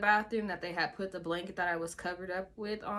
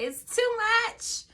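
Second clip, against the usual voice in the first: much higher and louder, slow and strained; distressed plea; 8 dB louder than usual. Retold or imitated. Patient pleading with nurse about the oxygen, distressed. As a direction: cry out that it's overwhelming. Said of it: "I was like".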